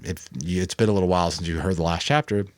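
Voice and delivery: ordinary delivery, nothing marked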